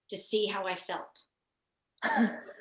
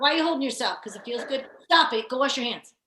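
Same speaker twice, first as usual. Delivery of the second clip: imitated grandmother, raised and bossy; scolding grandmom; 3 semitones higher, 7 dB louder than usual. Grandmom telling a child to wash hands, scolding. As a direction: briskly order a child about, mock-scolding. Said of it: "my grandmom, she would call us".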